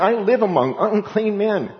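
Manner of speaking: high, panicked cry of a doomed man; terrified Isaiah. Retold or imitated. Isaiah crying out in dread before God's throne. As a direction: plead in terror before God. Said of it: "He's just going".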